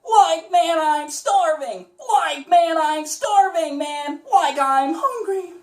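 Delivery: high goofy hungry stoner; Shaggy voice. Shown as a demonstration. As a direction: land the Shaggy voice. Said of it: "we're getting closer to Shaggy".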